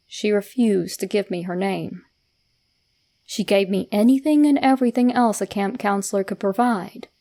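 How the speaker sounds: ordinary delivery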